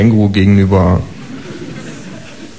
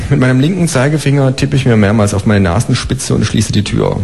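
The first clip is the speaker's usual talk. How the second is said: ordinary delivery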